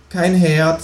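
high, chatty kangaroo voice, pitched well up. Acted. Kangaroo asking the new neighbour for eggs, high and chatty. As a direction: play the cheeky kangaroo high and casual.

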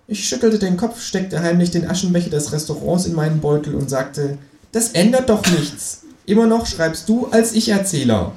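high-pitched creature voice telling the tale; kangaroo as narrator. A narrator's reading. Kangaroo takes over narrating against the writer, high voice. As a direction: narrate smugly, pitched well up.

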